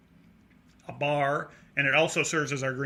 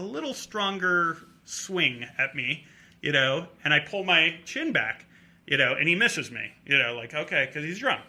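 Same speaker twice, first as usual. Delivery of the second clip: ordinary delivery, nothing marked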